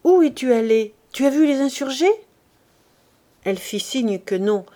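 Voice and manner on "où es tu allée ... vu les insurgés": urgent, louder worried asking; anxious questions. A narrator's reading. Pierre pressing Félicité, anxious questions. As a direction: press her for answers, uneasy. Frightened husband questions wife.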